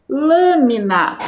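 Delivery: "Lâmina" drawn out slowly, first syllable strongest; stress on first syllable. Shown as a demonstration. Teacher reads the word for children to find the strong syllable, first syllable stressed. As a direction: read "Lâmina" slowly, leaning on the LÂ. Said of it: "qual é a sílaba mais forte quando nós pronunciamos".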